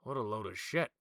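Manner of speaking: a loud contemptuous dismissal; sour and frowning. Acted. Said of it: "kind of sour face, like, kinda frowning".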